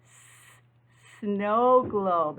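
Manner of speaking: a long hissing "S-" before "sandwich"; teacher drawing out "S-" for kids; hissed S sound. Shown as a demonstration. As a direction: stretch the "S-" into a hiss, then say "sandwich". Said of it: "Say it with me".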